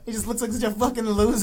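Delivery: ordinary talk, nothing marked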